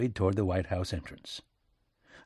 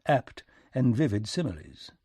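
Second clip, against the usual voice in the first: ordinary delivery, nothing marked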